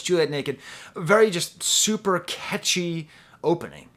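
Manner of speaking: ordinary delivery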